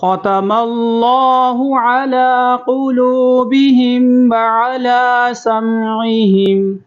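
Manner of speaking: slow, high melodic recitation of ختم اللہ علی قلوبہم; model recitation. Shown as a demonstration. Teacher recites so students can listen carefully, slow melodic chant. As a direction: chant it slowly for students to copy. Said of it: "میرے ساتھ آپ بھی دہرائیں".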